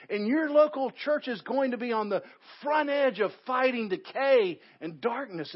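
loud, high preaching push; fiery sermon climax; preaching at full force. Acted. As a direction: drive it home to the congregation.

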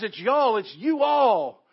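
loud, high preaching push; fiery sermon climax; preaching at full force. Acted. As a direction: drive it home to the congregation.

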